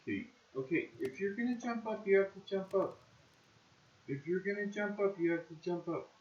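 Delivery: high soft coaxing voice. Real, not acted. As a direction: coo at the cat to jump. Coaxing cat to jump.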